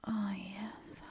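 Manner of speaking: usual speaking voice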